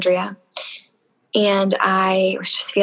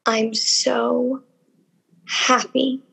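tearful; 4 semitones higher, much slower than usual. Real, not acted. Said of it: "Oof, now here I go".

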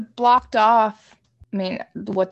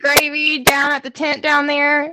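put-on Southern accent; 5 semitones higher than usual. Southern Sherpa offering food at the tent, Southern accent. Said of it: "if it was a Southern Sherpa".

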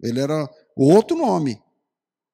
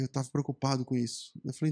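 usual speaking voice

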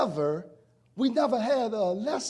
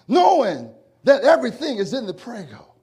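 normal talking voice

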